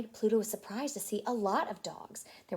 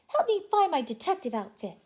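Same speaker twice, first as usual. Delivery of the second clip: Mickey voice; 6 semitones higher, 5 dB louder than usual. Acted.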